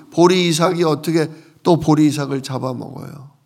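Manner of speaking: ordinary talk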